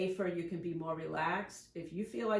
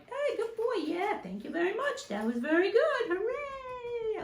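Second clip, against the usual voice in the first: put-on much higher, bubbly praise for a good boy; happy praise for dog; 14 semitones higher than usual. Shown as a demonstration. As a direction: cheer the dog on in a high sing-song. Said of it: "I'm like".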